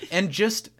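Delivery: ordinary delivery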